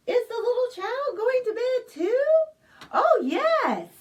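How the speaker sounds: high, fast, loud eager question; high delighted child-like cheer; excited. Acted. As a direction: ask with bubbling excitement.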